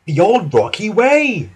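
high, excited moment of realisation; light up with a sudden bright idea; eager character-voice discovery. A narrator's reading. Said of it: "he thought excitedly".

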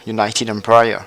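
"United Empire" said the English way, YOO-nai-ted EM-pai-er; a French gamer putting on a mock English accent; hammed-up English accent. Shown as a demonstration. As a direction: show off an exaggerated English accent on the name. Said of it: "vous avez vu cet accent".

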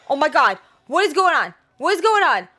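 loud, high, repeated disbelief; exasperated rant. Real, not acted. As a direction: hammer each question out in exasperation. Exasperated rant as things go wrong.

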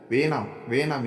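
ordinary delivery, nothing marked

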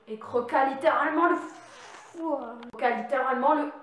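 loud, strange put-on narrator voice; weird voice. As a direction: growl the line in a creepy voice. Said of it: "je prends une voix très bizarre".

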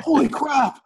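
high-pitched stunned exclamation; mock amazement. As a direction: play up the technician's astonishment. Retold astonished reaction.

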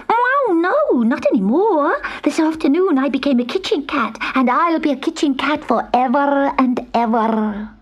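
high-pitched little kitten voice; eager young cat character, pitched well up; earnest kitten. Acted. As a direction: protest high, then settle into proud insistence. Kitten protests it is no witch's cat.

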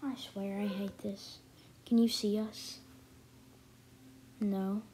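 usual speaking voice

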